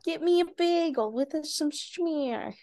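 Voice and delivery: slow Brooklyn deli order; New York accent. Pretend. Ordering a bagel in a mock Brooklyn accent. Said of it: "that sounds more New York".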